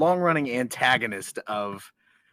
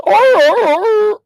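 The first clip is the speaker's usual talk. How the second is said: very high, very loud yelp like a dog's bark; imitated dog bark; 16 dB louder than usual. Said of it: "And he goes".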